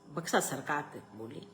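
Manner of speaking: plain reading voice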